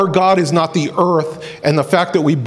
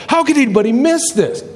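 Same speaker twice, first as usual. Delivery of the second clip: high-pitched, astonished question; incredulous; 8 semitones higher than usual. Acted. Believers seeing what was hidden, incredulous. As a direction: marvel that it was ever missed.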